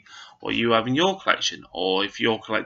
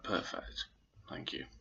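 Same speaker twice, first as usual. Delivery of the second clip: whispered; 4 semitones lower, 16 dB quieter than usual. Retold or imitated. Retold awestruck thanks to Goldberg.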